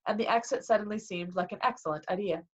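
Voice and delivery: plain reading voice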